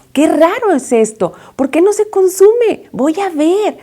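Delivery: acted curious amazement; puzzled wonder. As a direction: wonder aloud, curiosity lifting the pitch. Moses puzzled by burning bush.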